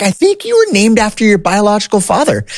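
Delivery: high put-on voice for mom gently breaking news; imitating mom. Mom explains his birth name.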